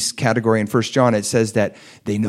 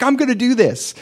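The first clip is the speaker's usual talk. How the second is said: high, eager burst of resolve; excited; 13 semitones higher than usual. Retold or imitated. A mother promising to teach her kids, excited. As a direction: quote her thrilled promise, pitched up. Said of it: "She was so excited".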